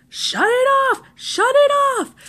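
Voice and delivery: high-pitched, drawn-out mock yell; imitating angry brother. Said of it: "He's getting really, really angry".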